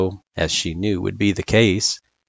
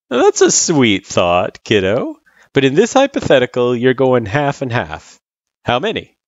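higher, louder friendly tutor voice; fond start, then back to the lesson; Sans, amused tutor; 5 semitones higher, 5 dB louder than usual. Acted. Sans steering kiddo back to halves, amused.

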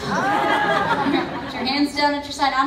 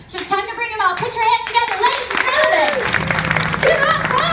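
big showman announcer call; 12 semitones higher, 6 dB louder, faster than usual. Acted. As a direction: hype the crowd like a ringmaster. Announcer introducing Eddie to crowd.

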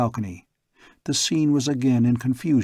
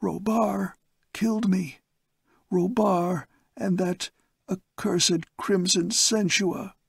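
higher, slow, breathy last words; faint dying gasp; 8 semitones higher, slower than usual. Acted. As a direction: force out the words as life fades. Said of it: "He gasped faintly".

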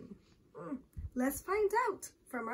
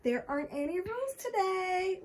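put-on high, sing-song child's taunt; giggly teasing; 6 semitones higher than usual. A narrator's reading. Rachel reminds Michael of no rules, giggly teasing. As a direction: tease playfully in a raised voice. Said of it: "reminded him with a giggle".

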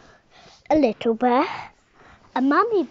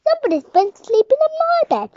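much higher, loud and fast; shouting bear; 13 semitones higher, 9 dB louder, faster than usual. Acted. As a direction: yell it out in a rush.

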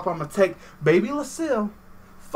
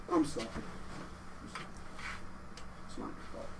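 whispered, soft mock apology; mock tender whisper; 20 dB quieter, much faster than usual. Pretending to console the beaten dummy, soft whisper. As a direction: hush it out like comforting a child.

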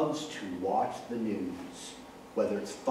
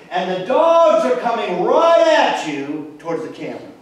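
much louder, higher build to the finish; excited climax; 9 semitones higher, 11 dB louder than usual. Acted. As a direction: tell it like a race caller at the finish. Dramatic race climax.

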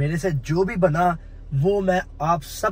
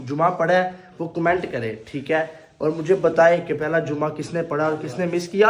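normal talking voice

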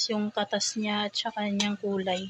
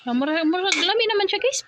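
excited; 8 semitones higher, 4 dB louder than usual. Real, not acted. Tasting her dish with delight.

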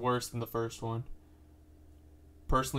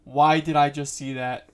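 very loud, high outburst easing down; startled shock; 3 semitones higher, 15 dB louder, slower than usual. Real, not acted. Viewer shocked by what they just saw, startled loud cry. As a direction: cry out in real shock, drawn out at first.

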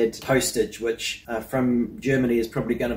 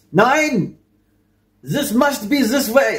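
a hammed-up German accent, much higher and louder; a strict mock German engineer barking "Nein!"; stronger German accent; 10 semitones higher, 3 dB louder, slower than usual. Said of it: "That's better".

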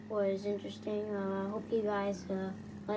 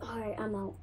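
normal talking voice

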